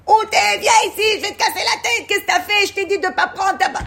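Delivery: hammed-up furious parent yelling; mock angry scolding. Teacher imagining God yelling at Adam, mock furious. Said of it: "venir avec les nerfs".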